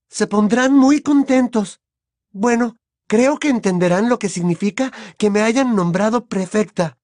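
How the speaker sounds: put-on very high, eager girl's voice; Hermione excited. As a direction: ask a favour excitedly in a high girlish voice. Narrator plays Hermione. (chica ilusionada, voz muy aguda)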